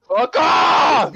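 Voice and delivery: high, loud mock-outraged yell; playful shout. Real, not acted. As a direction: yell it in joking protest.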